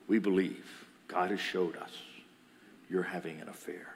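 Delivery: low, hushed pronouncement; solemn accusation. Retold or imitated. Family gathered to accuse daughter, solemn accusation. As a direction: lay the charge softly and gravely, pitched low.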